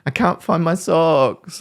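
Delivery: put-on high, slow, whining child voice; whiny victim child. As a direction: mimic a helpless kid whining, pitched high. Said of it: "This whinging, complaining".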